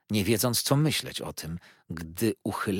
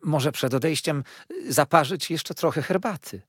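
politely; 6 semitones higher, 3 dB louder than usual. A narrator's reading. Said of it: "spytała uprzejmie".

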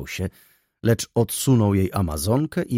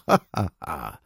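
lower, helpless laughing ha ha ha; rolling laughter; 4 semitones lower than usual. A narrator's reading. Widow laughing at Wokulski, helpless laughter. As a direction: laugh until she rocks in the saddle. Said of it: "Tarzała się na siodle ze śmiechu".

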